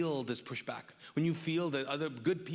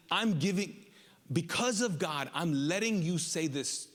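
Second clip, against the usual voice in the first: raised, driving sermon voice as Jesus; fervent preaching; 4 semitones higher than usual. Acted. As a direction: preach it like a defiant verdict. Preaching at full force as Jesus.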